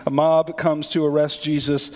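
ordinary talk

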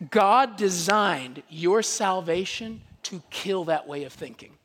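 ordinary talk

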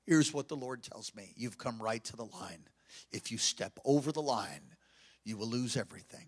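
low, hushed retold warning; quiet, heavy-hearted prophecy; solemn warning through tears. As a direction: warn them gravely and softly. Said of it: "So as I'm weeping, I tell them".

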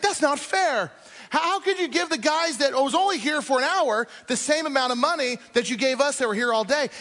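high, indignant workers' complaint; grumbling protest. Acted. Workers grumbling at the landowner, high and indignant. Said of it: "they start to grumble against the landowner".

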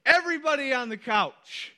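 high-pitched summons to the family; dad's emphatic call. Retold or imitated. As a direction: call everyone in from across the house. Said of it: "This is how we emphasize something in my house".

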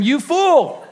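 high, pressed rebuke; stern rebuke. Retold or imitated. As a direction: scold him as a fool. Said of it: "But God said to him".

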